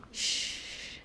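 whispered shh; hush. Acted. Jesus hushing the storm, whispered. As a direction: silence it with a breath.

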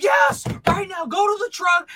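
hammed-up frantic command; mock panic. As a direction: shriek orders like a panicked friend. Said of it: "he's all doing it in a panic".

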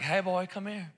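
a touch of a wife's quiet come-here; wife beckoning. Retold or imitated. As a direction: lightly call him over, hushed and teasing. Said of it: "she's like".

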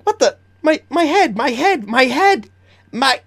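high, frantic creature voice; panicking kobold. Acted. Kobold clutching at its head, high panicked yelping. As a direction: yelp in high-pitched confusion and pain.

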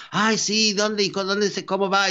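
high, eager rapid questions; eager questioner. Retold or imitated.

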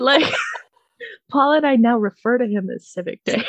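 ordinary talk

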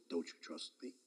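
a voice luring him to lie down; soft deceiving voice. Retold or imitated. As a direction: coax gently, almost under breath. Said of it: "the voice said".

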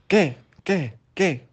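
high, loud, separate bursts; exasperated demand. A narrator's reading. Fox loses patience with the stalling pig, exasperated.

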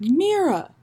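drawn-out greeting, swooping high; high singsong hello to a friend; Michael Silverblatt. Retold or imitated. Friend spotting her at the theater, high swooping greeting. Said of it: "he was like".

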